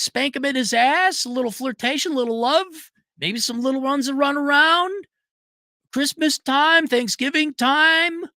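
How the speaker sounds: high mock-sweet voice lecturing a crybaby; mocking whiny woman. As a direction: sneer it up high like a fake-sweet scold. Mocking a crying liberal woman.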